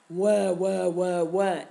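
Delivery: deep mock baby wail, wah wah; baby crying in a deep voice. A narrator's reading. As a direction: cry like a baby, but deep. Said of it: "in his deep voice".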